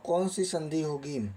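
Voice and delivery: ordinary talk, nothing marked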